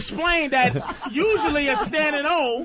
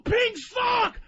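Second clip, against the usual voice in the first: heckling crowd shout; 10 semitones higher than usual. Retold or imitated.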